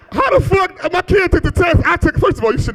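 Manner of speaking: high-pitched indignant rant; exasperated parent's outburst; outraged disbelief. Acted. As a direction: protest in comic outrage, pitch way up. Outraged over the kid's failed test.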